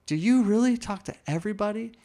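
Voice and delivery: high incredulous question; amazed. Retold or imitated. Asking Zach in disbelief, high amazed question. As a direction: ask it in disbelief.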